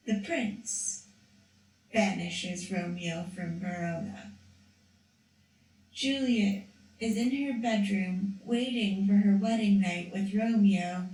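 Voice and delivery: usual speaking voice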